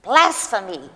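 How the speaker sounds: loud outraged cry of blasphemy; screamed accusation. Acted. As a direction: hurl the word out in outrage. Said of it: "tore his robes and screamed".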